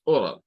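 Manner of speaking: oral with or and all joined; English oral. Shown as a demonstration. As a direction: say oral as one clean word. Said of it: "Son los dos sonidos". (oral pronunciado en inglés, or-al)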